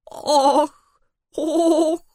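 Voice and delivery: high sobbing cries; heartbreaking sob. Acted. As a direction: sob out each cry. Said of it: "всхлипнула совсем уж душераздирающе". (душераздирающе всхлипывая)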